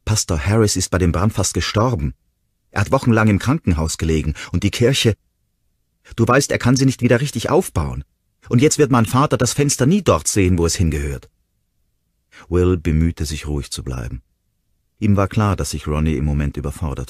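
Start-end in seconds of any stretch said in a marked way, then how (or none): 0.0-11.3 louder and higher, rising fury; angry outburst. A narrator's reading. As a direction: hurl the accusation at him. Ronnie enfadada, se levanta.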